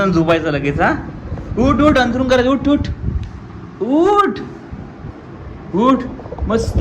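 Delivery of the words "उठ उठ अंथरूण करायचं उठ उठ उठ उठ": high, insistent calls of get up; calling him up. Real, not acted. Father calling sleeping son to get up, insistent and rising.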